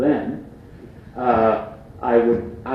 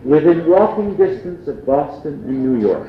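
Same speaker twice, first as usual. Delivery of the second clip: higher, louder mock sales pitch; newspaper ad pitch; 5 semitones higher, 6 dB louder than usual. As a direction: sell the key phrase like an ad. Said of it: "that's the key phrase".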